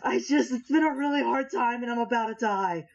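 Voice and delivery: clutch him and overshare; overemotional apology. Acted. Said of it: "I go".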